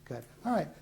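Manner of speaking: usual speaking voice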